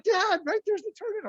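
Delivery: high mock kid voice pointing out the turn; high, urgent imitation of a child; kids calling out. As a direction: squeak it like the kids in the back seat. Kids call out missed turn.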